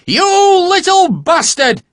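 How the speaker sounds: furious high scream; a mother's enraged shriek; screaming in rage. Acted. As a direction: scream at the boy in full rage. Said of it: "a woman stood there screaming in rage".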